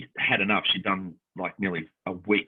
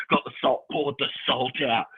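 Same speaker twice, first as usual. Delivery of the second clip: usual speaking voice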